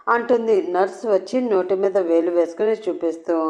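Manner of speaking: ordinary delivery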